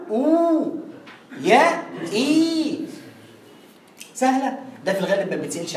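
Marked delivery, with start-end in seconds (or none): 0.1-0.7 "أو" as a higher, long oo; waw of madd. Shown as a demonstration. Teacher summing up for students, "أو" held long. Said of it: "واو". 2.1-2.8 "إي" as a higher, very long ee; yaa of madd. Shown as a demonstration. Teacher summing up for students, "إي" held long. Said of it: "لو سئلت عن حرف مد".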